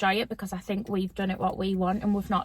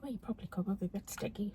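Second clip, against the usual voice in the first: unvoiced hushed whisper; 3 semitones lower, 14 dB quieter, faster than usual. Real, not acted. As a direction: drop to a whisper. Whispered aside.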